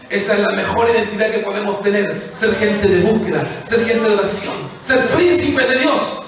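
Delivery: preaching climax. Acted. Preacher confronting the congregation, loud and soaring. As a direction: hit the peak of the sermon.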